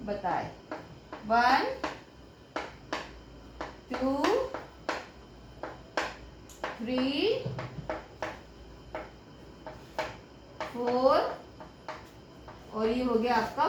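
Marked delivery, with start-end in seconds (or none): 1.3-11.4 slow counting. Shown as a demonstration. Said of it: "ये जो मैंने आपको बताया".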